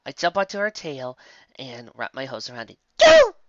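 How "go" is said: put-on very high, loud kid shout; child's yell. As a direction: bellow it like an impatient little child. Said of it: "I yell".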